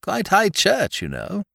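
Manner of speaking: high, slow, drawling grand dame; imperious wealthy widow. A narrator's reading. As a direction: savour each word like a dowager. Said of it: "said the Dowager".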